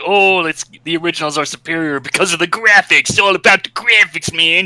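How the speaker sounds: high, whiny snobby fan voice; mocking fanboy. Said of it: "the fan base kind of really put me off".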